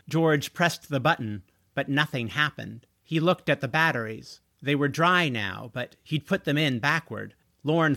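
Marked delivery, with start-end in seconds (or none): none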